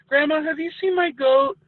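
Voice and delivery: put-on high, innocent little boy asking; childlike question. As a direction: pitch it up like a worried kid.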